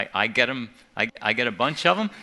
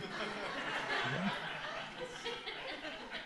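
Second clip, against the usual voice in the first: high soft laugh; 11 semitones higher, 12 dB quieter than usual. Real, not acted. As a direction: laugh at the punchline. Laughing at own story.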